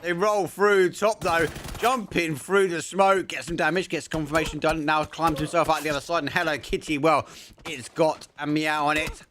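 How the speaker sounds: high, loud frantic play-by-play; excited. Real, not acted. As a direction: hype up the fight call. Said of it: "I'm sorry, I'm crazy".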